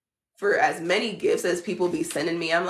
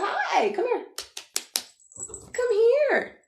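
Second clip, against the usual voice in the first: pet-talk voice; 12 semitones higher than usual. Real, not acted. Owner calling her dog in high baby talk.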